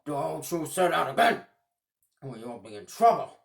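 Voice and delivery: loud, threatening growl; Big Klaus loudly threatening Little Klaus; angry warning. Acted. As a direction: warn him off loudly. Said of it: "Big Klaus yelled".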